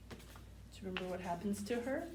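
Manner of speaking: usual speaking voice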